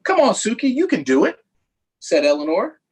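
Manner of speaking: ordinary delivery, nothing marked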